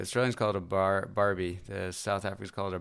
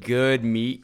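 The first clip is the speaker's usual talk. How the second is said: ordinary delivery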